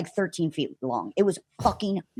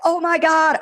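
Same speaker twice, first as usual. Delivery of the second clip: higher and louder, stunned; amazed exclaim; 11 semitones higher, 4 dB louder than usual. Retold or imitated. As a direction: react to the second ride. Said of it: "we're like".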